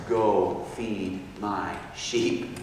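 very slow, low, soft charge; tender commission. Retold or imitated. Jesus restoring his wounded disciple, tender and slow. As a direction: give each word room, hushed.

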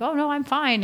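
high-pitched dismissive insistence; mock breezy denial. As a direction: brush off concern, imitating someone in denial. Voices ill people in denial.

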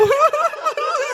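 loud high cackle; high cackling laugh. Real, not acted. Bursts out laughing.

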